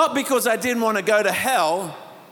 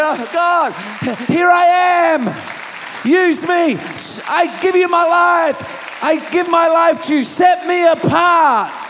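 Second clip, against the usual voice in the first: loud, high cry of surrender; slow, drawn-out pleading shout; impassioned prayer; 8 semitones higher, 6 dB louder than usual. Acted. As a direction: cry out to God at full force. Reenacts crying surrender at altar.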